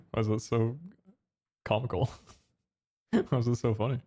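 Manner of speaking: laughing in disbelief; horrified amusement. Real, not acted. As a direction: laugh at how absurd it looks. Particles flying randomly.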